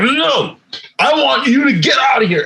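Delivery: high-pitched mock tirade in a Japanese accent; mock going-off. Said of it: "he was going off on".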